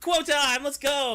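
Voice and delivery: rally for the quote segment; hyped cheer. Real, not acted.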